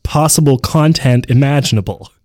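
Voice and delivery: sudden very loud sales emphasis; booming ad read. Pretend. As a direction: blast out the promise in the ad read. Sudden boom inside sponsor read.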